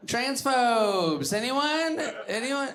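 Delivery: high, drawn-out call into the room; calling out to crowd. Acted. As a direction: fish for a response, mock hopeful. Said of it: "calling to crowd".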